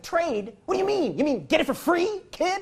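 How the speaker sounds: high, loud incredulous retort; hammed-up outrage; mock indignation. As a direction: scoff at the kid. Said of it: "And I was like".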